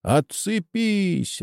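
high, stretched-out yell. A narrator's reading. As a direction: yell it long, without much force. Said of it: "орёт Чеба из-за бука". (протяжный крик)

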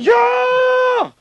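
sudden high shouted yes; a loud, high indignant yell; outburst. Acted. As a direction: explode with the word. Grandpa erupts mid-story.

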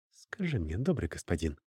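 hushed, low and soft; quiet whisper. A narrator's reading. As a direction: murmur it softly, almost whispered. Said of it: "тихо шепнула Фиано".

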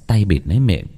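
ordinary delivery

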